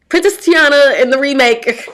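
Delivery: big excited announcement; excited blurt. Real, not acted. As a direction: blurt the dream out, loud. Blurts out her dream role.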